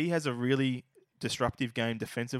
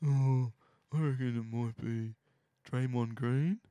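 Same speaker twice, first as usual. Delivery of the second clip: lightly mocking the co-host, near normal voice; understated sarcastic imitation, drawn-out pondering; slower than usual. Podcast host mimics co-host's obvious pick, understated mocking. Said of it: "I'm mocking you".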